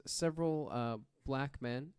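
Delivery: normal talking voice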